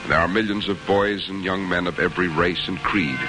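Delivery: ordinary talk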